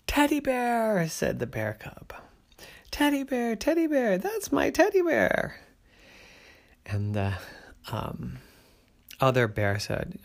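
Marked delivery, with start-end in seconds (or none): 0.1-5.6 high, thrilled cry of recognition; a cub overjoyed to see her teddy; excited cub. A narrator's reading. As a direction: squeal with joy at finding it. Cub finds her bear.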